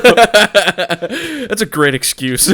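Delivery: slow, laughing through words. Real, not acted. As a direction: laugh while saying it. Laughing at the excuse.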